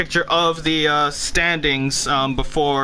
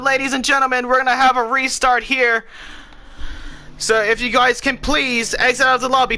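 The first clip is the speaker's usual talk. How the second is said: raised, upbeat race announcer; 6 semitones higher than usual. Acted. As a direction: announce it to the whole lobby. Race host announcing restart to lobby.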